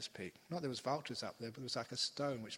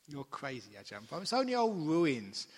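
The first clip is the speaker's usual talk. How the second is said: brushing it off, louder and higher at the end; dismissive scoff; 3 semitones higher, 7 dB louder than usual. Retold or imitated. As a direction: scoff at the idea like a sceptical old lady. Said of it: "she said".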